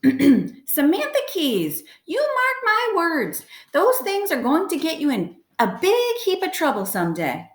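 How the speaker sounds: high, slow, drawn-out warning; scolding grandmother. A narrator's reading. Worried grandmother warns granddaughter about binoculars, high slow scolding. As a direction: wag a finger in a high, measured voice.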